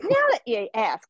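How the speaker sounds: high, put-on mentor voice; imitating a playful I'm-glad-you-asked; teasing lead-in. Judge answering champion who asked for critique, teasing.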